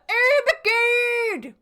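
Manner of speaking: Ermengarde belted out loud and high, each syllable hit; correct pronunciation. Shown as a demonstration. Streamer correcting the game's Ermengarde, shouting. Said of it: "They never say it correctly".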